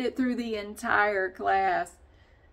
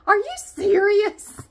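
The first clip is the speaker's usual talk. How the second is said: much higher, much louder, slow disbelieving groan; imitating the lady; 13 semitones higher, 11 dB louder, slower than usual. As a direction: ham up the lady at each new exercise. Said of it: "whenever we start doing something different".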